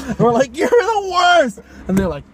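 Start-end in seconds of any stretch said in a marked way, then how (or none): 0.6-1.6 high, loud, drawn-out complaint; hammed-up indignant shout; retold outraged yell. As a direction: wail it slowly at them in mock outrage. Retold yelling at airline staff.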